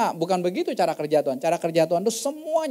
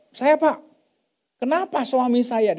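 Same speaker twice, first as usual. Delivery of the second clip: daughter-in-law complaining; 7 semitones higher, 3 dB louder, slower than usual. Retold or imitated.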